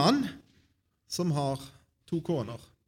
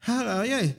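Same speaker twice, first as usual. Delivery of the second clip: put-on high young Samuel voice; eager boy answering; 8 semitones higher, 4 dB louder than usual. A narrator's reading. Samuel comes to Eli a third time, eager reply.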